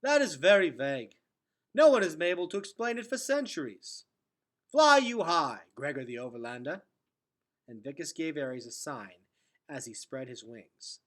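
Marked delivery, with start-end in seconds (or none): 0.0-6.8 put-on old man farewell. Old Vicus farewell, voiced.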